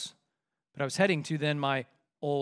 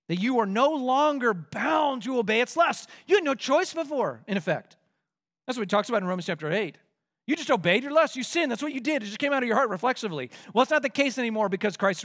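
raised, insistent sermon voice; higher-pitched preacher's urging; emphatic preaching; 7 semitones higher, 3 dB louder than usual. Real, not acted. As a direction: drive each short sentence home from the pulpit. Preaches with force.

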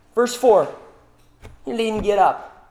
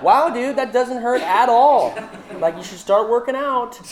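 mock unimpressed; 5 semitones higher, 3 dB louder than usual. To a spoiled kid who thinks he's strong, mock unimpressed. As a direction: brush off a kid's punch like it's nothing. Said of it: "I'm just gonna be like".

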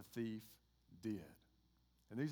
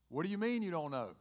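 higher, louder, mock-incredulous angel; baffled questioning; 6 semitones higher, 8 dB louder than usual. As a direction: press the thief in disbelief, acted. Angel questioning the clueless thief.

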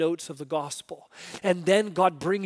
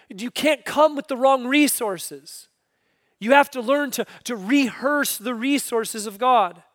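preaching; 8 semitones higher, 7 dB louder than usual. Acted. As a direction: preach it with force. Preaching the lesson.

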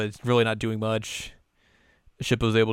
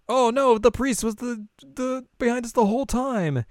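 high mock alarm; high alarmed girl; 13 semitones higher than usual. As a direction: gasp it out. Voicing alarmed girls.

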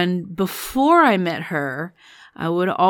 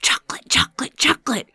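put-on hushed little-kid chant rising higher; excited half-whispered child voice; inner child's voice; 6 semitones higher, faster than usual. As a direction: imitate an eager seven-year-old in your head. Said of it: "I hear this in my head".

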